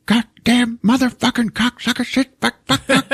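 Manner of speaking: high, strained imitation of a cursing dad; mock angry cursing. Dad cursing downstairs at the furnace, mock angry. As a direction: spit out each swear as the mad dad. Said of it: "curse when he's mad".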